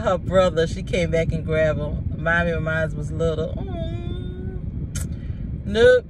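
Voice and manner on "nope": ordinary talk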